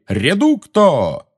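high, drawn-out incantation cry; exclaimed spell. A narrator's reading. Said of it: "Пять разных голосов позади него воскликнули".

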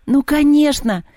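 high, excited cry of realisation; yelling. Acted. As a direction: yell it as the idea hits you. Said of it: "завопил он".

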